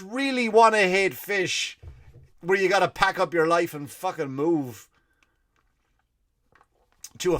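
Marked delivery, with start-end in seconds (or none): none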